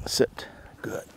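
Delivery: normal talking voice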